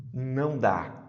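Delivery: ordinary delivery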